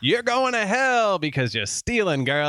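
high-pitched scolding in a bad Irish accent; hammed-up Irish priest. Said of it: "That's not a good Irish accent".

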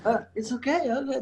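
high, soothing hospital staff; reassuring. Retold or imitated. Staff calming the Russian dad.

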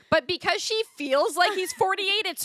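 high, laughing aside. Real, not acted. Laughing at the character ages.

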